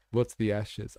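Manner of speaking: ordinary talk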